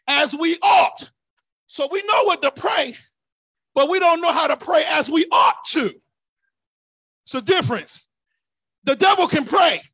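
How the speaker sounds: shouted, rising sermon delivery; fiery preaching. Real, not acted. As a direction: preach it hard to the church. Preaching at full force.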